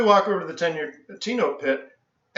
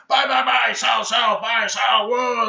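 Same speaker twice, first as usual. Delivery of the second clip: trader shouts; 4 semitones higher, slower than usual. Retold or imitated. Traders shouting orders at the open.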